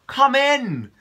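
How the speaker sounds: high, bright call from the water. Acted. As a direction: call them in across the river. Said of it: "he called".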